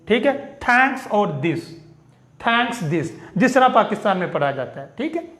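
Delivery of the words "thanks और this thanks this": "thanks" hard th, "this" dental d, slow; slow careful local thanks and this; Pakistani pronunciation. Shown as a demonstration. Teacher models for students the simple local way, slow and clear. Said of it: "आप simply इसको थ पढ़ें".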